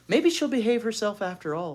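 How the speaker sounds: hope aloud she'll be good; hopeful thought. Acted. Beezus thinks hopefully.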